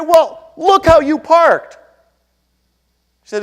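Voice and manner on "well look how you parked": loud, high and slow accusing retort; indignant scolding. Retold or imitated. Husband scolding the young woman about her parking, loud and slow. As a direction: slowly rub her nose in the bad parking.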